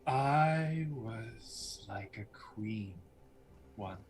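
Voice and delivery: very slow, breath-heavy near-whisper; hushed, faded-queen musing; wistful villainess. Acted. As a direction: draw the words out softly, remembering lost glory. Game master voices Lucretia.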